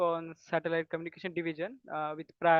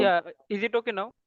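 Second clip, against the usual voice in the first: speaking up, louder than before; 3 semitones higher, 10 dB louder than usual. Real, not acted. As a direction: raise the voice so all can hear. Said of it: "You can be little loud".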